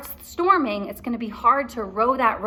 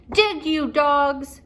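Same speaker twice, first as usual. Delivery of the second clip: slow, loud drawn-out command; captain forcing the dig; shouting captain; 5 semitones higher, 4 dB louder, much slower than usual. Acted. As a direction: drag the order out loudly. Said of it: "cried Captain Bones".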